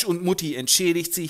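ordinary talk

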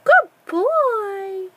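high, loud praise for a pet; excited praise. Real, not acted. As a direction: cheer the bird on. Praising bird loudly.

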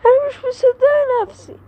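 high, loud outburst of disbelief; shocked disbelief. Acted. Sister reacting to Amelia's rudeness, shocked and loud. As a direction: she can't believe what she hears.

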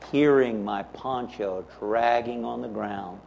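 ordinary talk

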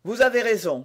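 loud, decisive cry; exclaiming. A narrator's reading. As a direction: exclaim it with some force. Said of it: "s'écria le gentilhomme".

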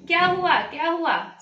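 high, loud alarmed cry that settles; worried family rushing to the child; alarmed calling out. Acted. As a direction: call out in worry, running in. Everyone rushes in alarmed.

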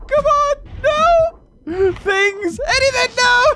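very high, loud, crying wail; desperate cry. Real, not acted. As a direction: wail in despair as it all goes wrong. Dying in game, crying out.

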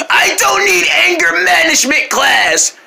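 angry yell. Acted. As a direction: wail it again, drawn out. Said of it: "you yell at everybody so much".

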